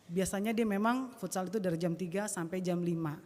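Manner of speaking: normal talking voice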